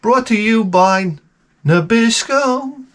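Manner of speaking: high, slow, drawn-out sponsor announcement; mock ad announcer. Host breaks into a fake ad spot, high and slow. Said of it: "we have advertisements in the show".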